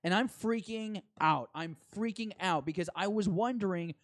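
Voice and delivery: usual speaking voice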